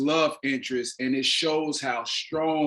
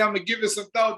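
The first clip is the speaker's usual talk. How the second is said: mock evasive reply; 8 semitones higher, much faster than usual. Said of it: "somebody start rocking like this and say".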